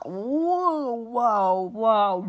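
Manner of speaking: loud, drawn-out whoas; loud mock-offended reaction; mock outrage. Reacting to a cheeky birthday note, mock outrage.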